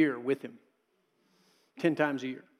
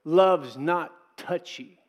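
Love's not touchy, each word slow and weighted; slow emphatic reading; much slower than usual. Acted. Preacher to congregation, weighted slow reading. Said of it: "gets to that point".

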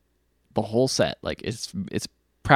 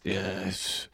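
put-on low drunk mumble; 6 semitones lower, 8 dB quieter than usual. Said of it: "and he says".

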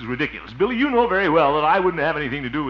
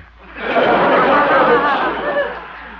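giggle; 12 semitones higher, 10 dB louder than usual. Real, not acted. Laughing at his own joke.

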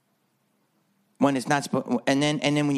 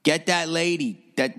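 normal talking voice

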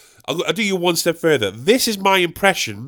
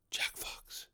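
eerie drawn-out whisper; ghostly whisper; 23 dB quieter than usual. Pretend. Lottery winner vanishing from everyone, ghostly whisper. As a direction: whisper your name like passing wind.

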